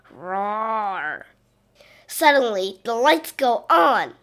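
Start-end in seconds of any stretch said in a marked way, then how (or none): 0.1-1.2 low, soft growling roar; a distant dragon growling. Acted. Spooky noises in the dark.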